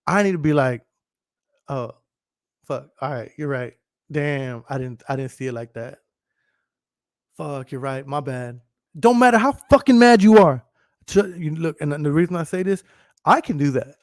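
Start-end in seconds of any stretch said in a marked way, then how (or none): 1.7-8.6 soft, deflated admission; subdued concession. Acted. Arguing spouse admitting the other is right, quietly conceding. Acts conceding point mid-argument. 9.0-10.6 raised voice, high and forceful; loud insistence. Real, not acted. Streamer to viewers on conceding while mad, loud and emphatic.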